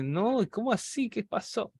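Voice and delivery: put-on the boy can't believe what he sees, astonished. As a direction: gasp it out, caught off guard.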